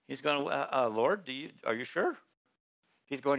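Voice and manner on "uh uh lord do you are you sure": timid doubt. Acted. Gideon doubting God.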